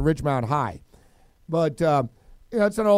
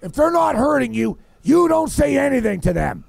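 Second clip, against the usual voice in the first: imitated angry scolding, raised pitch; father freaking out; 6 semitones higher, 4 dB louder than usual. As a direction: lay down the law like an irate dad. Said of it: "he's freaking out".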